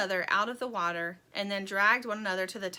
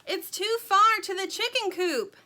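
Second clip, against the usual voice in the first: raised, higher storm yell; 10 semitones higher, 6 dB louder than usual. A narrator's reading. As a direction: shout it over the pouring rain. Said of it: "yelled Dirt".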